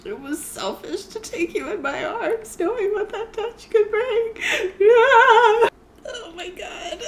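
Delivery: reading aloud through real tears, voice high and cracking; crying while reading. Real, not acted. Reader to her viewers, reading the love scene through real tears. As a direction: let the tears break the lines as you read. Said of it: "I'm gonna cry".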